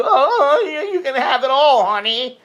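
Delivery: high, honeyed seductive voice; mock sweet-talk. Sweet-talking a man to win him over, in a mock honeyed voice. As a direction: coo it like a gold digger. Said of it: "and then you".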